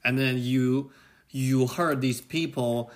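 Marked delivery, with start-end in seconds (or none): none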